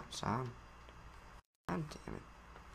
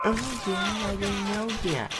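higher, pleading little son; whiny son voice; 7 semitones higher than usual. Acted. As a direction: play the hurt kid asking dad.

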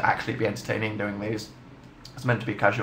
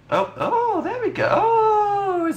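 surprised; slower than usual. Real, not acted. Surprised at chalk working.

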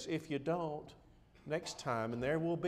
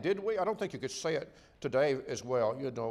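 raised, insistent preacher's voice; fervent preaching; 7 semitones higher, 6 dB louder than usual. Acted. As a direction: press the point home like a pulpit sermon. Preaching at full force.

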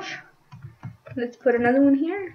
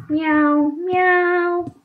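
high, drawn-out meows; cat meowing; 6 semitones higher than usual. Pretend. Player greeting a cat in the game, cat meowing. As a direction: mew like a kitty.

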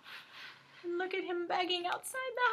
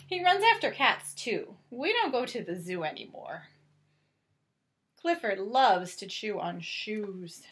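lightly animated storybook narration; reading to children; 7 semitones lower than usual. A narrator's reading. Reading Clifford aloud to a child, gentle storybook voice. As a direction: read it out gently to little ones. Reads picture book aloud.

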